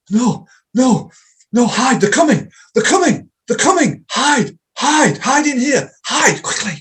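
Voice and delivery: high, loud, frantic pleading; terrified panic. Acted. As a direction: panic as if hunted. Reenacting terrified client in trance.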